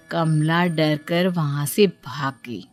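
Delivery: plain reading voice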